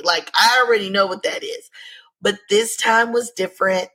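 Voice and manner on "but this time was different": normal talking voice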